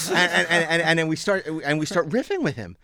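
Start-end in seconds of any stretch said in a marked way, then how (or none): none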